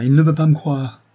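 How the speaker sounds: loud, high cry of protest; distressed, forceful exclamation; exclaiming. A narrator's reading. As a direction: burst out, stung at not being believed. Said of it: "s'écria Norbert".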